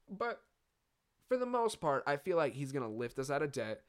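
ordinary talk

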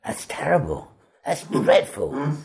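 imitating an appalled verdict; appalled critic. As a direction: pass a withering verdict on a bad audition. Assistant condemns his Swedish attempt.

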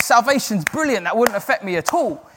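high, loud upbeat quote; enthusiastic approval. Retold or imitated. Preacher imitating his reaction to salvation stories, enthusiastic approval. Acting out his delight at testimonies.

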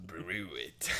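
low, odd croaky parody; parody lizard voice. Retold or imitated. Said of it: "Game Grumps remake where they parodied".

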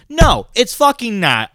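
high, loud, slow retort; heated rebuttal. Real, not acted. Shutting down an imagined caller, high, loud and slow. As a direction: shut the caller down hard.

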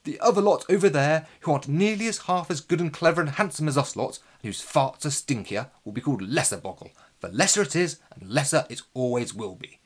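loud pompous proclamation; proclaiming lord. A narrator's reading. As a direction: boast it like a vain lord.

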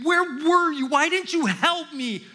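high, loud cry at an absent dad; retold screaming. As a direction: relive the yell at your father, half-voiced. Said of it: "I just started screaming".

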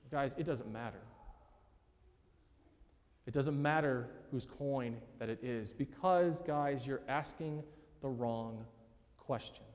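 low, soft, understated voice of a teacher; quiet correction. Acted. Voicing Jesus quietly correcting his questioners.

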